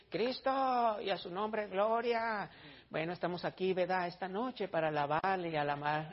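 soft, monotone churchy patter with verbal tics; lifeless church leader. Retold or imitated. Preacher mimics the churches he was used to, in a listless monotone. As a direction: drone softly through rote church phrases. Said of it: "yo estaba impuesto a ver las iglesias de".

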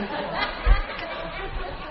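high, soft trailing laughter; real high, quiet laugh. Real, not acted. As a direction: dissolve into giggles at your slip. Laughs at own slip.

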